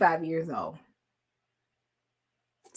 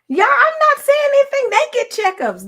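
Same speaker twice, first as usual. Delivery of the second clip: much higher, louder and fast; high excited ribbing; high loud teasing; 9 dB louder, faster than usual. Real, not acted. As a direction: call out the men playfully. Teasing men in audience.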